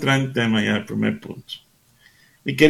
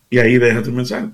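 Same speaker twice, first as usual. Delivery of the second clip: ordinary delivery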